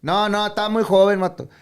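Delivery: high, loud imitated dad; dad's voice. Quoting dad refusing contract.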